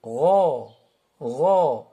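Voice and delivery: "Gha" low and heavy, like "gho"; emphatic ghain. Shown as a demonstration. Teacher shows emphatic letter, heavy low "Gha". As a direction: round out a long, thick "gha". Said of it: "elle est emphatique".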